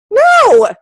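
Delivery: very high, loud sharp no; emphatic no. Real, not acted. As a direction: shut it down hard. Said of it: "Say no".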